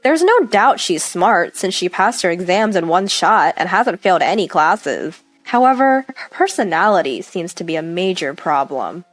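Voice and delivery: ordinary delivery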